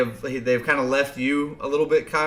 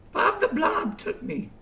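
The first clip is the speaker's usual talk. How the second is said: very high little character voice; Gitmo; 4 dB quieter than usual. Acted. Gitmo tells the party where he went, high squeak. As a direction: squeak it in falsetto, a little softly. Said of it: "the one and only Gitmo".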